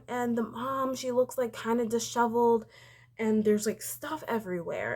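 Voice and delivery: usual speaking voice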